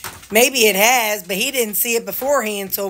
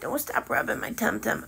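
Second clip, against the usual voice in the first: whispered dog voice; 4 semitones higher, 6 dB quieter than usual. Pretend. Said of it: "He says".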